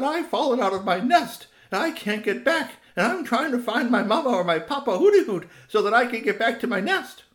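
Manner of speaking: high, loud little owl voice; an eager owlet hooting out his words; baby owl. Acted. Lost owlet asks a stranger for help, high and loud.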